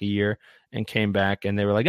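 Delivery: ordinary talk, nothing marked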